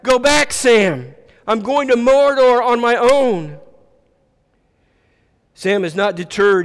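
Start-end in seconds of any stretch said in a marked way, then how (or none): 0.0-3.6 high, loud yell; yelling back. A narrator's reading. Frodo in the boat sending Sam away, yelling. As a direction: shout him away, pitched up. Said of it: "Frodo yells back".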